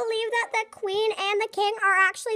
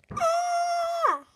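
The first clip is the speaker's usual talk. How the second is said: high pretend scream of fright; mock scream; 8 semitones higher, much slower than usual. Child in pretend play, screaming in fake fright. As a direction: shriek as if the queen appeared.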